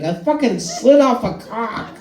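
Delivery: high, whining admission through a laugh; high laughing whine to the nurse; whiny high confession. Retold or imitated. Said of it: "I was like".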